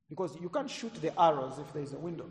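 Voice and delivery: usual speaking voice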